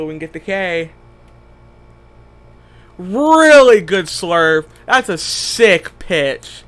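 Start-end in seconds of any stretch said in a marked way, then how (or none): 3.0-6.6 loud, slow, drawn-out hype; excited. Real, not acted. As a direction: savour the strikeout, loud and slow. Hyped over his own strikeout.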